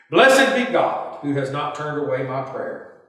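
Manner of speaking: usual speaking voice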